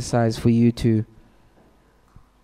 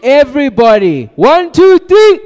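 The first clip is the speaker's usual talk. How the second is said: very high, loud count-in; shouted crowd cue; rallying shout; 11 semitones higher, 11 dB louder than usual. Real, not acted. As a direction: yell to get everybody joining in. Rallying audience to shout along.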